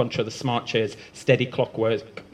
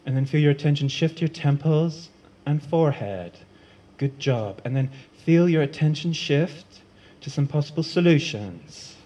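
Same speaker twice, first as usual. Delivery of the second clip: 1 mock guided meditation. Ash leads a mock meditation.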